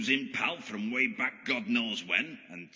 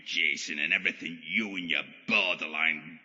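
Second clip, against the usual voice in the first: ordinary delivery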